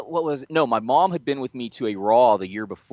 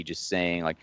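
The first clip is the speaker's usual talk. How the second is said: normal talking voice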